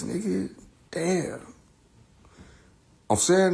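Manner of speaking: ordinary talk, nothing marked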